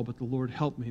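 ordinary delivery

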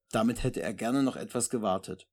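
usual speaking voice

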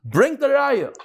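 high, forceful mock shout; half-acted outcry, pitched well up; retold scream. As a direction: shout it out like an exasperated rabbi at a wedding. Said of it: "and he screamed out".

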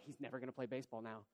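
low, quick, muttered imitation of wife; mock wife voice. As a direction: grumble it softly as the wife. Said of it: "She doesn't really talk like that".

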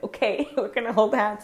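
laughing quote. Retold or imitated. Sister laughing as they hold hands, giggly and bright. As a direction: laugh while saying it, playful. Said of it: "And she was like".

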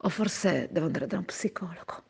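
soft whisper, half joking; whispered aside. Real, not acted. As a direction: breathe it like a guilty confession. Self-mocking aside.

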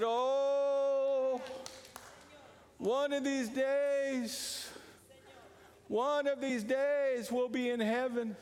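drawn-out, sighing mock preacher voice; mocking a resigned preacher. Preacher mimicking passive preachers' sighing, mock drawl. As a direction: lightly parody the passive preacher, slow. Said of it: "And said".

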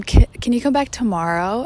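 put-on pleading kid asking her friend to return; imitating a child. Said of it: "she's like, um".

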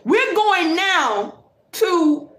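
normal talking voice

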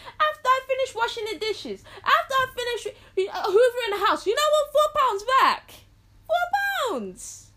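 high, loud mock outrage; acted indignant outburst. Acted outrage at parent taking money back.

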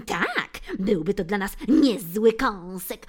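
loud, gleeful cat agreeing; laughing sidekick cat. Acted. Phil agrees with Bill, loud gleeful cat. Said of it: "odparł kot, prawie dławiąc się ze śmiechu".

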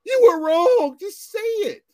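high, loud exasperated push; telling his girlfriend to just admit it, pitched high and loud. Retold or imitated. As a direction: demand she admit it, voice way up.

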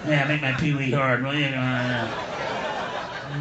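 low, soft mock moaning; aroused Pee Wee. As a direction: imitate a man privately getting turned on. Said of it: "an adult theater going".

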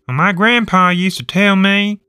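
high, loud character voice; voiced local storyteller. Acts out a sample storyteller opening.

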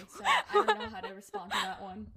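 high, loud, laughing admission. Real, not acted. Stumped by climate change question, laughing loudly. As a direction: laugh helplessly through the words.

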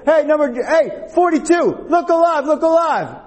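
high-pitched holler at a daydreaming player; urgent sideline yell; imitating a coach's sharp call. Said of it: "she yelled out".

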